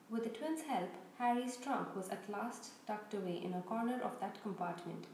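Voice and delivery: ordinary delivery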